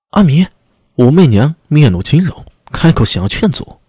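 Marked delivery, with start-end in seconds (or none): 0.1-0.5 sudden high alarmed outcry; alarmed cry. Acted. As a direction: blurt it out in shock. Wu Meiniang cries out alarmed.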